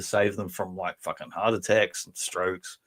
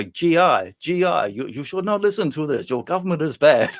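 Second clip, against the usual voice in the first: higher, louder mock Vietnamese accent; 6 semitones higher, 4 dB louder than usual. Imitating Tour of Duty radio telling soldiers not to listen, mock Vietnamese accent. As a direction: parody a Vietnamese propaganda radio broadcaster, pitched up. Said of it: "not to do stereotype accents".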